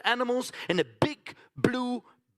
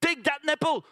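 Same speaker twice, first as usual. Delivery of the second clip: put-on child coaxing piglets; 7 semitones higher than usual. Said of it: "So, so I'm saying".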